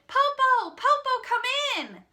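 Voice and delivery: put-on high excited children's shout. A narrator's reading. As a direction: call out happily and loud. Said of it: "They shouted".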